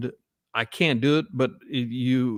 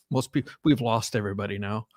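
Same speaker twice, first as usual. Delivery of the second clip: normal talking voice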